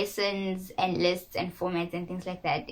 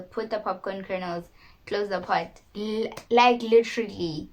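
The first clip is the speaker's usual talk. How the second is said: ordinary talk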